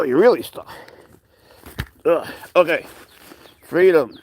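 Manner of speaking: high, bright pet talk; high-pitched fussing over a stuck cat. Real, not acted. Freeing the cat from a sled.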